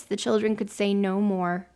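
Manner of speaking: ordinary delivery, nothing marked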